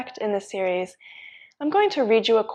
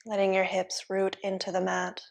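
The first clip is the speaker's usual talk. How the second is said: soft, even yoga-teacher voice; hushed, level meditation guidance; guided relaxation; 6 dB quieter than usual. Real, not acted. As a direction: ease them into stillness, softly, with long pauses. Leads guided relaxation in pose.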